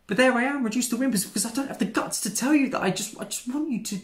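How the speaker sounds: high, loud, flustered outburst; worked-up self-reproach. Acted. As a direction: blurt it out high, frustrated with yourself. Flustered failed proposal, self-reproach.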